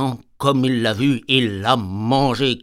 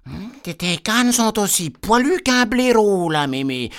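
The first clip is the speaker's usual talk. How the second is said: put-on little girl voice; 8 semitones higher, 3 dB louder than usual. Little girl asks her granny about her arms, high and puzzled.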